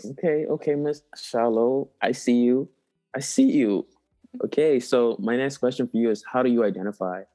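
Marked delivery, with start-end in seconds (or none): none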